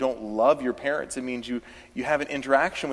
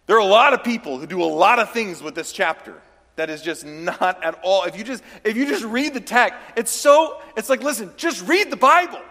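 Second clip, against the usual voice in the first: preaching at full force, higher and louder; heated preaching; 7 semitones higher, 5 dB louder than usual. Acted. Preacher urging the church to just read the Bible, loud and high. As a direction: press the point hard to the congregation.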